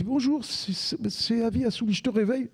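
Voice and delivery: collègue imitée, voix nettement plus aiguë. Retold or imitated. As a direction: imite sa collègue qui l'appelle à l'aube, ton enjoué et aigu. Collègue au téléphone à l'aube. (collègue qui le réveille au téléphone, voix plus aiguë)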